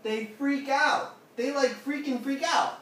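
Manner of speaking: loud, high-pitched, punchy emphasis; emphatic excitement. Real, not acted. Preacher answering what people do meeting God, emphatic excitement. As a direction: drive it home at high pitch and volume.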